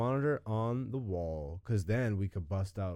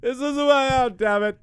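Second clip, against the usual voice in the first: high, loud laughing protest; laughing at himself out loud; embarrassed laughing; 13 semitones higher, 11 dB louder than usual. Real, not acted.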